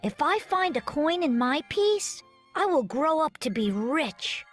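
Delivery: ordinary delivery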